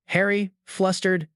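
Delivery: ordinary delivery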